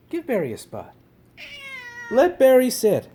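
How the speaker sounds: loud, high, insistent order; exasperated scolding. Real, not acted. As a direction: scold a pushy pet loudly. Scolding the interrupting cat.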